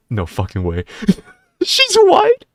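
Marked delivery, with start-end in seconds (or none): 1.6-2.4 much higher, louder, laughing in disbelief; can't believe it, laughing. Real, not acted.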